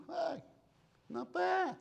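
high-pitched mock-impressed reaction; impressed surprise. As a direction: pitch it way up, pleasantly surprised, without volume. Reacts on walking in.

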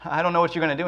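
a leader losing his nerve, begging God for answers; panicked plea to God. Acted. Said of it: "turned around and goes".

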